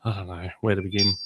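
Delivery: soft unvoiced whisper, half to herself; whispered aside. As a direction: whisper it like you're lost for where to start. Mock helpless aside to herself.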